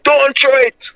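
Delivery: mild high-pitched retold plea; Emmanuel's plea. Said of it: "all you could hear is Emmanuel voice saying".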